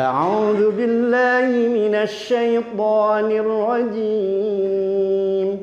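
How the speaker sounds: slow, drawn-out Quranic recitation. Shown as a demonstration. Teacher reciting slowly for the class. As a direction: chant it slowly with tajwid. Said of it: "Jim, ya".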